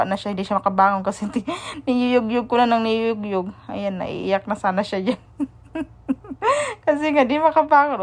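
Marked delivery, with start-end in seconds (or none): 5.4-8.0 laughing. Real, not acted. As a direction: talk through high, real laughter. Laughing as daughter can't get up.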